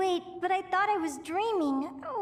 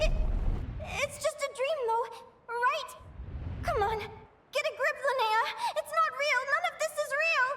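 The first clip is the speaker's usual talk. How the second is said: higher, tense pleading with herself; anxious self-reassurance; 9 semitones higher than usual. Acted. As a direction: talk yourself out of panic. Trying to calm herself.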